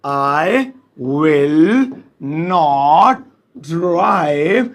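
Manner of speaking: each word set apart, slow. Shown as a demonstration. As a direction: dictate it word by word. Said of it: "तो English में होगा". (एक-एक शब्द धीरे-धीरे)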